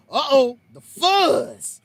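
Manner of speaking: loud, stretched-out mock alarm from mom; mom's comic alarm. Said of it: "here come my mom".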